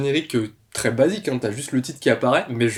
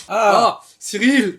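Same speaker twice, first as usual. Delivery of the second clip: high, loud cry of recognition; real fan excitement; delighted exclamation; 8 semitones higher, 6 dB louder, much slower than usual. Real, not acted. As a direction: call out a favourite's name as he appears. Favourite character appears on screen.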